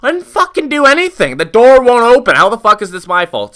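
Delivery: high, loud retold outburst; worked-up defensive shouting, re-enacted; indignant protest. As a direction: plead innocence at an exasperated yell. Retold protest to the accusing owner.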